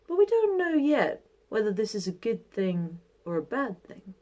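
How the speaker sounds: put-on calm, measured old man voice; wise old sage. Old man's calm reply.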